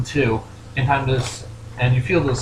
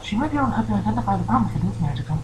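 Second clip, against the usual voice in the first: helpful pony in a high, gentle voice; Fluttershy; 9 semitones higher, 6 dB quieter than usual. Acted. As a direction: advise kindly in a small, high character voice. Voices Fluttershy advising the player.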